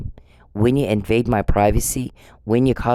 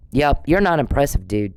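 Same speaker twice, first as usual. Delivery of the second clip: normal talking voice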